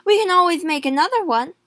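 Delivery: tossing off a cruel answer; husband brushing off his wife's fear; callous retort. A narrator's reading.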